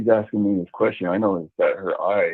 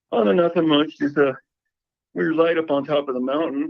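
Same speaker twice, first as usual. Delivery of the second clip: higher-pitched imitation of his sister, casual; imitating sister; 8 semitones higher than usual. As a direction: quote the sister in a lifted, higher voice. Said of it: "She's like".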